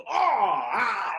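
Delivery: loud comic "oh, ah" of a face hitting a wall; hammed-up slapstick ouch; mock pained grunt. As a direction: yelp like a Three Stooges bonk. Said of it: "and they go".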